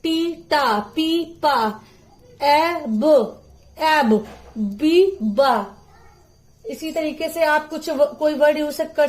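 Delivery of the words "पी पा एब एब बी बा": "पी पा" and "बी बा" slowly drawn out; slow phonic sounds. Shown as a demonstration. Teacher showing parents how to sound out letters slowly. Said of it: "ये जरूर बताइए".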